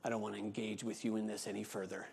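imitating her curt dismissal, quick and hushed; dismissive brush-off. The woman shutting down the pastor, curt and quiet. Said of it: "she says".

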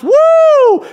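high, loud "woo" shout; wrestler's woo. Retold or imitated. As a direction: whoop it long and high. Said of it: "because he did it again".

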